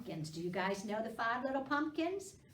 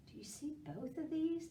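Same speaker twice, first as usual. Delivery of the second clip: plain reading voice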